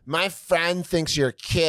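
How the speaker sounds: high, slow mock-girl voice; heckling girls. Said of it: "And they're like".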